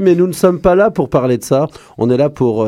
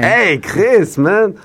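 put-on hype call; 7 semitones higher, much slower than usual.

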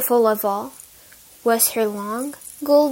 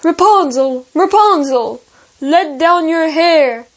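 calling up; 3 semitones higher, 5 dB louder than usual. A narrator's reading. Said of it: "he cried".

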